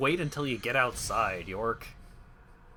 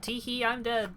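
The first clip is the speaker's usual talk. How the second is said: mock dead voice; 11 semitones higher, 3 dB louder than usual. Mock death in game.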